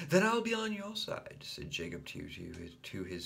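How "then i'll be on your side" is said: put-on high eager child offer; high childish offer; eager little boy. A narrator's reading.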